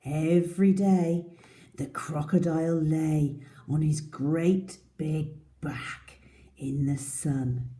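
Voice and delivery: lively read-aloud storytelling; story narration. Acted. Teacher reading the story to her class, lively. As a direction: read it to small children.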